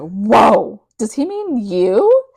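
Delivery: mock amazement. Friend reacting to the lunch invitation, amazed. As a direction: act out a stunned friend, stretching the words.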